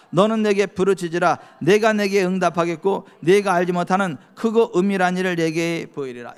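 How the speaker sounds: preacher reading Hebrews aloud; scripture reading. Acted. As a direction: proclaim the verse to the congregation.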